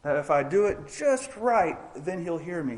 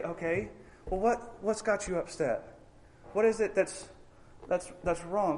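gentle parent; 4 semitones higher, 5 dB quieter than usual. Retold or imitated. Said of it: "Or are we gonna say".

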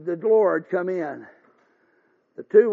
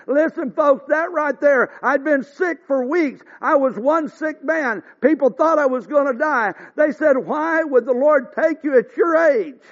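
preaching at full force; 4 semitones higher, 6 dB louder than usual. Acted. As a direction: hammer each word home to the congregation.

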